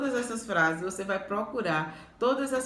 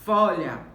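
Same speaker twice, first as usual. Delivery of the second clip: "Folha" with a loud, punched "Fo"; FO-lha, the "Fo" loud and the ending dropping; stress on first syllable; 5 dB louder than usual. Shown as a demonstration. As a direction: hit the first syllable of "Folha" hard, then fall. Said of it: "sílaba mais forte fó".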